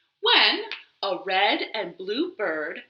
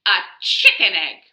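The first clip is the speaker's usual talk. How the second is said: know-it-all; 6 dB louder, faster than usual. Acted. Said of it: "who knew everything about everything".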